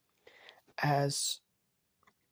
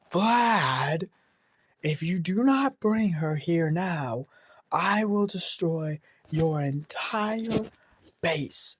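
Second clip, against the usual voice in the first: put-on loud, higher, slow threat from an old woman; crackly old woman; 3 semitones higher, 4 dB louder, much slower than usual. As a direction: bellow like a furious grandmother at the gate. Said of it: "an old woman voice, like, kinda crackly".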